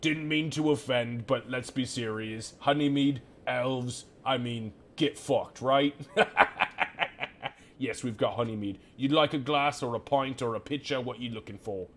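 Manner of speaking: half-orc barkeep scoffing at an elf question; mocking barkeep. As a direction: scoff at the customer, then laugh it off. GM voicing the barkeep.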